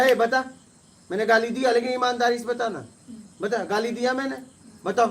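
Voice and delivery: high, forceful questioning; loud demand. Retold or imitated. Demanding the accuser tell honestly: loud, forceful questioning. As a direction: make him admit the truth, loudly.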